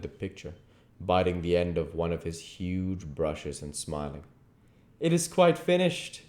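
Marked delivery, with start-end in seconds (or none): 5.0-6.2 higher, proud announcement; glad cry. Acted. Painter announcing his finished portrait, delighted. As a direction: declare the painting done, delighted. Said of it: "he cried".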